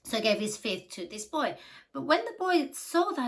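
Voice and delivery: usual speaking voice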